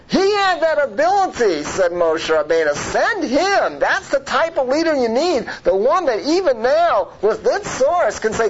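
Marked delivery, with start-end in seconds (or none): 0.1-1.6 high, emphatic preacher's rise; acted impassioned argument; impassioned urging. 2.7-8.5 high, insistent plea in a preacher's swing; acted fervent appeal; impassioned urging. Moses urging God to send Akiva.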